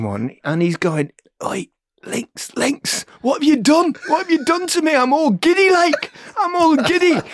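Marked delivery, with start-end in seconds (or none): 1.4-2.5 hushed, unvoiced stage whisper; breathless mock whisper to a teammate. Giddy Gazza whispering on pitch. 2.6-7.2 much higher, louder, slowed dizzy plea; high, loud, woozy mock complaint; giddy Gazza.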